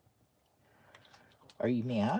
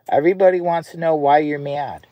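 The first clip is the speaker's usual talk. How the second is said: higher and louder playful ribbing; loud teasing; 4 semitones higher, 10 dB louder than usual. Real, not acted. Owner teasing his grumpy dog, loud and high. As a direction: tease her loudly for the camera.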